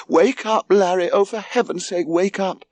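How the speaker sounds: high-pitched frantic rousing; urgent alarm. A narrator's reading. Mother shaking her sleeping son in a fire, frantic. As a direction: urge him awake, high and frantic.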